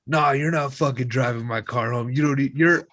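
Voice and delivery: mildly hammed-up, pushy refusal; indignant refusal. Drunk son won't let mom drive his car, indignant.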